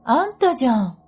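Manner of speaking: high, rising, disbelieving retort; incredulous. Retold or imitated. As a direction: push back in disbelief. S insists the speaker told it.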